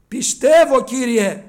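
loud cry of faith. A narrator's reading. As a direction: cry out to the Lord. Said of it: "φώναξε με δάκρυα στα μάτια". (κραυγή πίστης)

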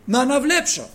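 high, loud cry of Κύριε; loud pleading. Retold or imitated. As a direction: call out to the Lord with all your heart. Bartimaeus pleads to the Lord. (δυνατή ικεσία)

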